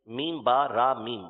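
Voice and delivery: slow, letter-by-letter spelling; spelled out. Shown as a demonstration. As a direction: spell each letter name slowly and separately. Teacher spells the term letter by letter. (ایک ایک حرف کے آہستہ آہستہ ہجے)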